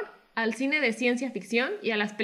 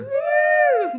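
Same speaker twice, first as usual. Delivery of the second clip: exclamación de festejo; 6 dB louder than usual. Real, not acted. Reacción a felicitaciones.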